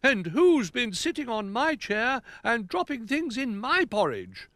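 high-pitched accusing bear; indignant Bramwell. Acted. Bramwell finds things in his porridge, high indignation. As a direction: demand it in a high voice.

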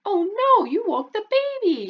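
high, dismayed mom. Acted. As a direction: scold as a flustered mother. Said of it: "your mom will say".

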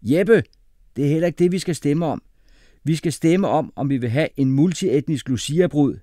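loud, high, insistent; firm correction. A narrator's reading. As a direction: set the classmate straight. Singh firmly corrects Jeppe.

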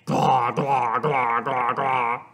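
low, rapid string of gags; mock gagging. A narrator's reading. Father chokes on play clay, mock gagging. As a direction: retch comically, over and over. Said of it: "And he yelled".

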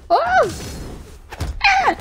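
two high-pitched yelps; startled cries. Real, not acted. Player nearly losing the match, high startled yelps. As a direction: yelp high in sudden alarm.

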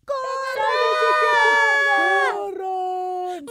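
high, stretched panicked yells; frightened kids' shouting; children screaming. A narrator's reading. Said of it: "Los niños empezaron a gritar".

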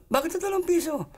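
very high, protesting question; hammed-up affronted disbelief; indignant mother. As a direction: protest the offer in a high pitch. Mother disappointed by small sum.

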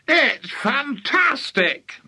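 high, slow, drawn-out marvelling; excited shout. A narrator's reading. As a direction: stretch it out in sheer delight. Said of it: "Mister Crankie shouted".

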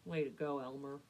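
low, sheepish drake. Acted. Drake awkwardly congratulating Elmer, sheepish. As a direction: mumble it low, a bit embarrassed. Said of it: "sheepishly kicked the dirt".

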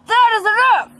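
full-force shout to stop the dogs; real yell. Real, not acted. Girl yelling at her dogs to stop, loud real shout. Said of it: "I'm yelling at you both".

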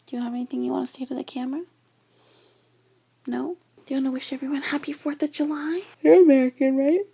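high cooing pet voice; talking to dog. Real, not acted. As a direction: coo sweetly at the puppy. Asking the dog questions.

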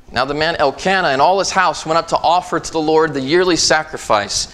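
preaching scripture. Acted. Pastor proclaiming Hannah's vow to the congregation, preaching. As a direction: drive the story home to the pews.

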